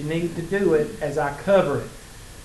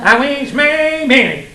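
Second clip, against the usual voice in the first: high, loud, drawn-out nasal whine; nasal mimicry; 11 semitones higher, 10 dB louder, much slower than usual. Teacher mocking a student's nasal voice, high and loud. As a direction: push it up into the nose and stretch it. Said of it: "she had this nasal thing going on".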